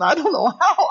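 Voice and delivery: normal talking voice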